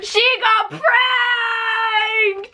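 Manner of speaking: high, drawn-out crowing laugh; gleeful shout. Real, not acted. Prankster crowing that her friend got fooled, gleeful. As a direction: crow over the prank.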